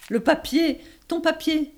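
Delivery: loud annoyed. Acted. Annoyed at wrapper noise in theatre.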